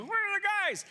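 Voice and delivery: very high mock shout; comic high-pitched holler after missing men; searchers calling out. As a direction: call it out in a squeaky put-on voice. Pursuers calling out, acted.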